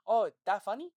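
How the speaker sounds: Giuliano. Retold or imitated. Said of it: "he's like".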